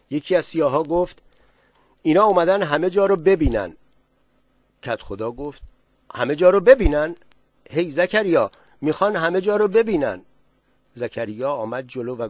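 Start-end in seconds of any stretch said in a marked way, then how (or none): 2.0-3.8 stranger's call. Acted. 6.1-10.2 alarmed headman. Acted. Village headman alarmed by request.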